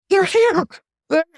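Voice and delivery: high, loud gasp of dread; terrified cry. Acted. Orestes sensing the Furies upon him, crying out in terror. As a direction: blurt it out in terror.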